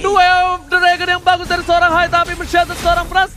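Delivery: excited caster. Real, not acted. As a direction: keep the hype rolling. Caster hype after kill.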